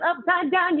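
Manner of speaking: ordinary talk, nothing marked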